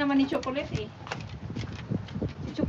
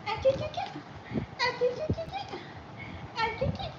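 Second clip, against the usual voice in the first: very high, soft cooing chu chu; cooing call; 10 semitones higher, 7 dB quieter, much faster than usual. Real, not acted. Cooing to the little one in a very high soft voice. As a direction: coo up high and soft like calling a pet.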